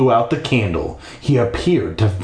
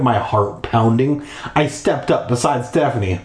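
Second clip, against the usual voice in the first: ordinary delivery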